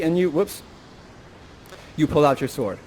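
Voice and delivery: ordinary delivery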